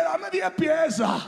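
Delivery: high, loud, slow demand; hammed-up fast-food shout; shouted order. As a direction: bellow the order like a revelation. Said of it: "Y yo dije".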